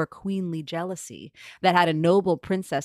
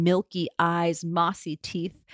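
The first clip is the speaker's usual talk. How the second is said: ordinary delivery, nothing marked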